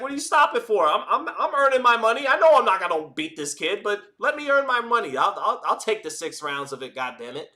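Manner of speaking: mock protest of a durable journeyman; imagined boxer's protest. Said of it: "He's like".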